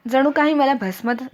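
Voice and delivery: plain reading voice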